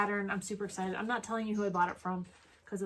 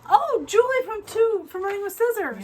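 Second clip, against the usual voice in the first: delighted squeal; 12 semitones higher, 7 dB louder, slower than usual. Real, not acted. Thrilled at seeing the heart hanger.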